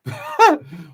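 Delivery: high, loud laugh; sudden real laugh; burst of laughter. Real, not acted. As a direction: laugh out at the comment. Reacting to a chat comment.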